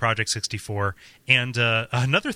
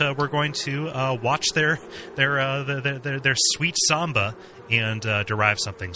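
ordinary talk, nothing marked

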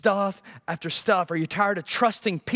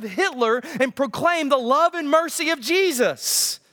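higher-pitched, driving sermon delivery; impassioned preaching; 6 semitones higher than usual. Acted. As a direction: build the case with mounting fervor. Preaches at rising force.

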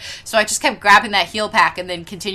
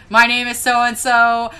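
loud, slow, higher deadpan announcement; mock matter-of-fact; 5 semitones higher, 8 dB louder, slower than usual. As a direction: imitate a gamer stating it as fact. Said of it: "so matter-of-factly".

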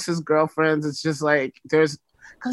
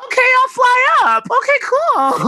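excited; 5 dB louder than usual. Real, not acted. Excited reply, much higher, louder.

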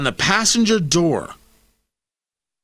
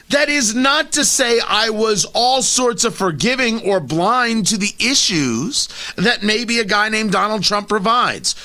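ordinary talk

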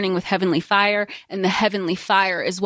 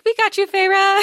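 high, louder mock rescue cheer; playful Selkies; 11 semitones higher, 4 dB louder than usual. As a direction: cheer her on like sea creatures. Voicing the Selkies' reassurance.

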